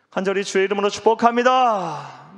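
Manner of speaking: high, loud ringing proclamation; preacher's blessing. Real, not acted. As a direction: shout the blessing out to the congregation.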